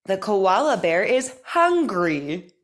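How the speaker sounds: slow, careful speech-therapy reading; crisp model sentences for repeating; over enunciating. Shown as a demonstration. Therapist reads practice sentences.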